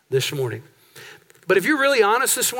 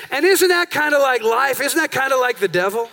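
normal talking voice

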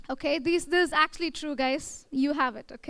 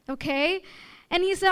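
normal talking voice

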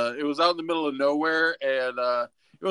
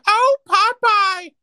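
Olive Oyl squeal; 9 dB louder, much slower than usual. Acted.

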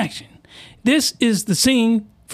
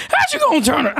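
cracked, higher outraged question, cut off; voice crack again; 6 semitones higher, faster than usual. Real, not acted. Retrying the line for viewers, voice cracking higher. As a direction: voice breaks on "How you gonna turn ar-". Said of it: "the voice crack twice".

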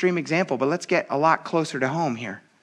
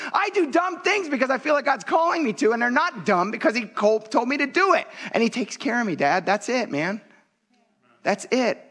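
higher, urgent plea to his father; earnest reply to dad; 8 semitones higher than usual. Retold or imitated. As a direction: insist passionately, pitch raised. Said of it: "And I had to say to him".

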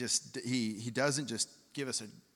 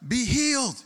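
high, loud stage-preacher cry; mock faith-healer shout; 11 semitones higher, 7 dB louder than usual. Mocking preachers on high stages, loud healing shout. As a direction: bark it like a showy healer on a big stage. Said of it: "could have just screamed".

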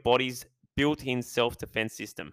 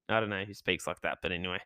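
normal talking voice

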